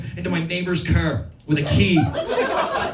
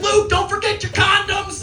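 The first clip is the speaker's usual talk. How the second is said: high calling mom voice; high mom shouting after her son; 12 semitones higher than usual. Retold or imitated. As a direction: call it out like a caring mom. Mom quoted.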